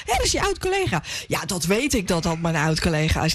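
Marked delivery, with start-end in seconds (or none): 0.1-1.0 high-pitched mock calling out; imitated call-out. Host quoting André to the guests, calling out high. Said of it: "André riep net".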